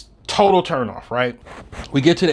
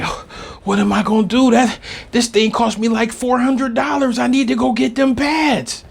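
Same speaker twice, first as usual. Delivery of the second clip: put-on upset girlfriend; 9 semitones higher than usual. Girlfriend voiced in the story.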